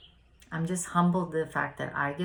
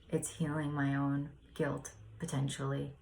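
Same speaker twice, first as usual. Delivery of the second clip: usual speaking voice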